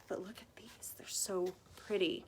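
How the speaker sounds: soft whisper over the craft; admiring whisper. Real, not acted. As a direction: hush it like a secret treasure. Showing pretty bling, hushed.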